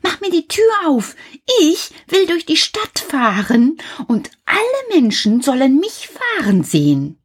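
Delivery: put-on high, excited little-boy voice; Häwelmann shouting. A narrator's reading. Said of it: "schrie Häwelmann".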